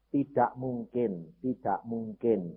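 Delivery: slow, soft, low repetition; quiet voice of giving up; resigned retold answer. Retold hopeless answer, slowly repeated.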